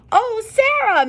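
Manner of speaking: high, loud gush of delight; an over-the-top greeting, high and loud. Acted. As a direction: gush over her like a thrilled principal. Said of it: "Missus Burton gushed".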